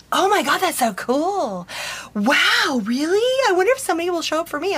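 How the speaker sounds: high-pitched gushing wonder; thrilled bride imitation. Acts out thrilled bride's reply.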